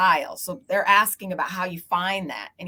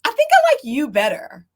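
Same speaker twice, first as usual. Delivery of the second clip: consultant's remark; 7 semitones higher, 7 dB louder than usual. Retold or imitated. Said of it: "And she was like".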